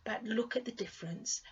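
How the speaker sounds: ordinary delivery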